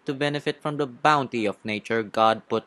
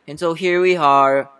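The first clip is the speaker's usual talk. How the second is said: theatrical wind-up; 5 semitones higher, 7 dB louder, slower than usual. Acted. Lawyer winds up an accusation.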